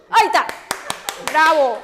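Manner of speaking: loud, emphatic cheer; loud praise. Real, not acted. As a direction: applaud the student with your voice. Teacher cheering a student's successful attempt.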